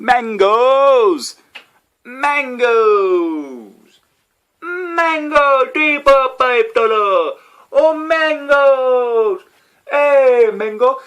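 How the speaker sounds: very high, very loud market yell; screaming hawker cry. Retold or imitated. Bouncing round the room imitating the mango seller, screaming. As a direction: shout it like a fruit-stall hawker. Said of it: "I'm screaming really loud and jumping around yelling".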